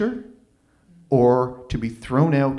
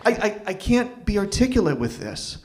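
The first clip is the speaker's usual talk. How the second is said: usual speaking voice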